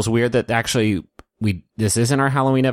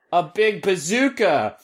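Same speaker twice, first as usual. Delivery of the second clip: hammed-up excitement; 10 semitones higher, slower than usual. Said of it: "Sound effects".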